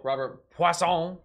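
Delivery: loud, drawn-out mock French accent. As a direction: ham up the name in a French accent. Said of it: "stereotypical French accent anyway".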